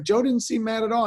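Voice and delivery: high-voiced sheepish protest; high defensive whine. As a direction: squeak out a weak excuse. Retold meek protest.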